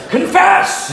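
very high, loud laughing shout; hammed-up zealot yelling at a drunk; over-the-top preacher cry; mock demand.

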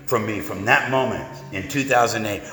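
normal talking voice

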